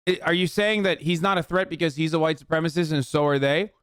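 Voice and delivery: normal talking voice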